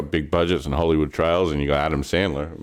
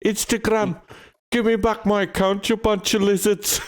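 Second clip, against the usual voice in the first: high squeaky impression. Fan mocking Paddy's Instagram rant in a high squeaky voice. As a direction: voice jumped way up, squeaky and odd.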